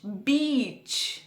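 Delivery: "बीच" with a very drawn-out ee; long ee. Shown as a demonstration. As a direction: slowly stretch the vowel in "बीच". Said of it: "Hindi शब्द बीच". ("बीच" की बड़ी ई को खींचकर बोलें)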